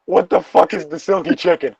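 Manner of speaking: ordinary talk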